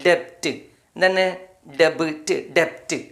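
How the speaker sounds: ordinary talk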